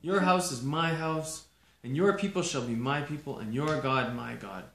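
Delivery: loud, fervent pledge; Ruth's vow. Acted. Ruth vowing to follow Naomi, loud and fervent. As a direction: swear loyalty loudly, with conviction.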